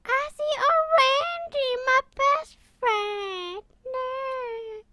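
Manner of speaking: hammed-up grief for best friend; mock wailing. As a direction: wail over your fallen friend. Mourning friend dead in game.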